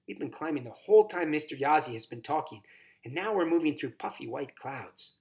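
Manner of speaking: ordinary delivery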